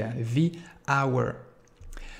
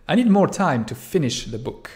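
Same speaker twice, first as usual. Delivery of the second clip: clear, louder English model sentence; example sentence read out; 3 semitones higher, 6 dB louder than usual. Shown as a demonstration. Teacher reads the English example to students. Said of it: "example sentence read to class".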